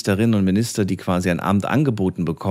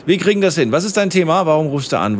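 to someone hard of hearing; 8 semitones higher than usual. Real, not acted. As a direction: speak up over road noise. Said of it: "Da hört man die Straße sehr laut".

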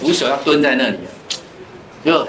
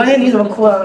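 higher, laughing teacher voice asking why; 6 semitones higher, 4 dB louder, faster than usual. Retold or imitated. Teacher asks the crying boy why he cries, laughing. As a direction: playfully imitate a teacher asking a crying kid. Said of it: "老师说".

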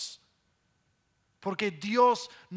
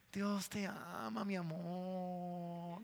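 much softer, very slow and sugary; syrupy soft voice; 3 semitones lower, 12 dB quieter, slower than usual. Retold or imitated. Mocking a syrupy preacher Mario is not, slow and hushed. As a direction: melt the words out slowly, sweet and hushed. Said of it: "No, él no habla así".

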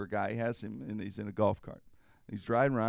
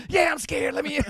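frantic admission; 8 dB louder than usual. Retold or imitated. Admits fear, locked out.

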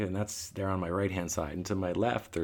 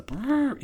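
high trumpeting brrr of an elephant; elephant squeak; 4 dB louder than usual. Shown as a demonstration. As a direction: mimic a small elephant squeak with the lips. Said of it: "that kinda squeak".

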